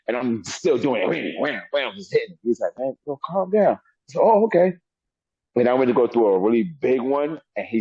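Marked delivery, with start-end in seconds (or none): 2.8-3.8 higher, slow, pleading calm down; slow him down like an uneasy partner; sparring partner pleading. Retold or imitated. Partner in the ring urging him, slow and higher. 4.2-4.7 high, louder sing-song okay; mock innocent okay. He mocks agreeing to the partner, high and innocent. His own fake agreement.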